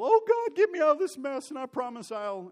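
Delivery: hammed-up cry for rescue; desperate prayer. Mock believer begging God to get him out of a mess, loud and panicked.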